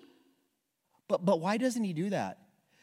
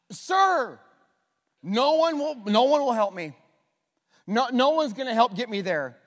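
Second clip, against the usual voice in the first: whiny excuse; 3 semitones higher, 3 dB louder than usual. Retold or imitated. Man's excuse voiced.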